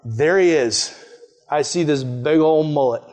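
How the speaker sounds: usual speaking voice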